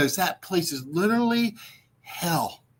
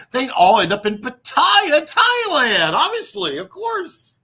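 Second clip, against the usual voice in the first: getting worked up, higher and higher; rising excitement; 10 semitones higher, 7 dB louder than usual. Real, not acted. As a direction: pitch climbing much higher, louder. Escalating rant about hell.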